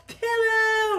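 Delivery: very high, loud squealed pillow; mock exultant shout. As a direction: cry out PILLOW in exaggerated delight. Same word repeated as shout.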